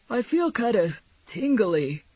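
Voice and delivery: dazed sidekick. A narrator's reading. Man tells partner how he feels after a stun-gun zap, dazed. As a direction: say it as if just coming round.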